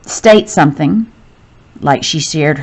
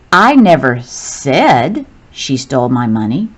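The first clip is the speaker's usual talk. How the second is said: "said" stretched and set off by a pause; stress on said; 4 semitones lower than usual. Shown as a demonstration. Teacher showing how italics change meaning, stress on "said". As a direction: lean hard on "said", then pause. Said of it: "Listen to how I read each of these".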